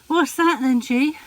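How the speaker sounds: high, loud cooing to the cat; pet talk. Real, not acted. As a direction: coo at the cat with delight. Owner talks to her cat.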